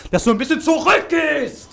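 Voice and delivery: sudden high shout. Shown as a demonstration. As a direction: yell it as a demo. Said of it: "wenn du dann schreist".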